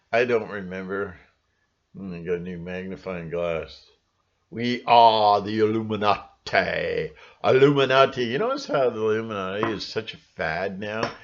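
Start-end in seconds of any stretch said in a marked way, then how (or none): 4.5-8.2 mock ominous announcement. Mock secret society voice.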